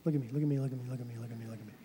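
quick clipped repeats of Look at me; rapid repeated command. Acted. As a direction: fire off Look at me over and over, fast. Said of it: "Don't look at the next verse".

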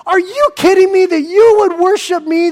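shocked angel refusing worship; angel protesting. Acted. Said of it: "the angels would say".

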